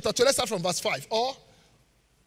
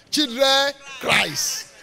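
very slow, louder, higher mock of bad English; mock wrong grammar; 5 semitones higher, 8 dB louder, much slower than usual. Preacher mocking parents' grammar, slow mock voice. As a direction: drag it out like someone who gets it wrong. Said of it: "some of you say it's true".

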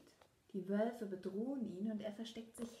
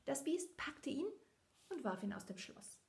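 plain reading voice

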